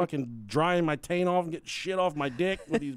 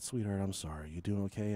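much softer, lower sympathy; tender condolence; 7 semitones lower, 8 dB quieter than usual. Retold or imitated. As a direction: comfort her gently. Consoling grieving wife.

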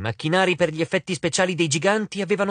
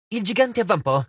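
usual speaking voice

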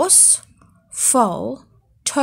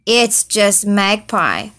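groaning; 5 dB louder, slower than usual. Acted. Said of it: "groaned Foal".

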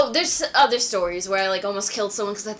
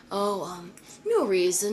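evasive stranger; 4 semitones lower, 6 dB quieter, slower than usual. Retold or imitated. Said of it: "He's like".

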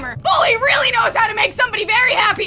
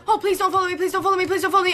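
low, repeated anxious begging; lightly panicked chant under pressure; panicking plea; 4 semitones lower, 4 dB quieter, faster than usual. Real, not acted. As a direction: mutter a nervous plea over and over. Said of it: "I'm just panicking".

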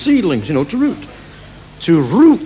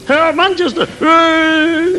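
crowd shout; 13 semitones higher, much slower than usual. Retold or imitated.

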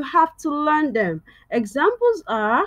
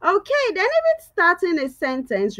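ordinary delivery

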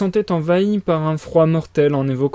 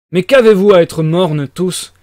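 loud, slow, abrupt cry; a slow, forceful outburst; sudden shout; 3 semitones higher, 8 dB louder, slower than usual. A narrator's reading. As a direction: bark it at the silent room. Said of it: "cria-t-il brusquement".

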